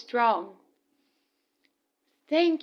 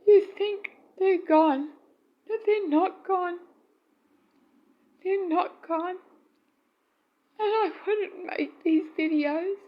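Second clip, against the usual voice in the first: tearful; 6 semitones higher than usual. Real, not acted. Grieving widow, voice breaking.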